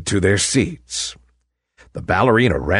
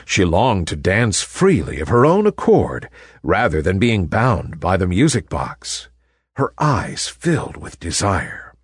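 plain reading voice

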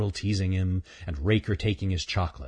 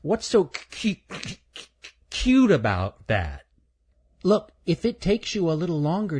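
put-on high child voice stuck on cu- before cute; a stammering young boy, repeating the first sound; stuttering; 10 semitones higher than usual. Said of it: "Stuttering Stan".

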